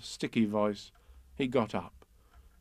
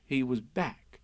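ordinary delivery

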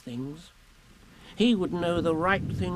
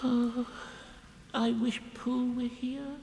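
soft, slow, lonely sigh of a wish; wistful and sad; 6 semitones higher, 6 dB quieter, slower than usual. Acted. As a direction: sigh the words out, alone and longing. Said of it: "he gave a very long sigh and said".

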